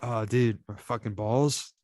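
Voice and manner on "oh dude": normal talking voice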